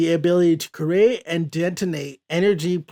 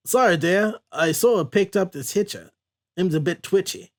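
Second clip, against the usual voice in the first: put-on hammy, unconvincing Cajun accent; terrible Cajun accent; 2 semitones higher than usual. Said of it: "that was a terrible Cajun accent".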